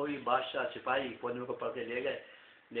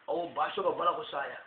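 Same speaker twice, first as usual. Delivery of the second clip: ordinary delivery, nothing marked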